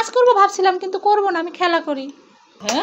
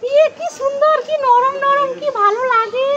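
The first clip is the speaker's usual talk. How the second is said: delighted squeal; 6 semitones higher than usual. Acted. Mother showing her son the balloon, delighted and high. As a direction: coo with delight at a squishy toy.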